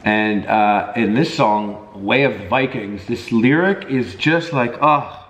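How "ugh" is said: ordinary delivery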